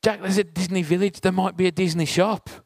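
excited daughter. Retold or imitated. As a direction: retell her bursting with the news. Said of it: "And Eva said excitedly".

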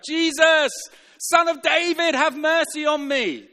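loud, high-pitched desperate shout; calling out. Acted. Blind beggar calling out to Jesus as he passes, desperate shout. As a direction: cry out loudly to be heard over a crowd. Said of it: "He called out".

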